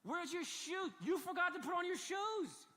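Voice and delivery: put-on exasperated father calling after a barefoot child; God scolding. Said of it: "That's what I think God would say".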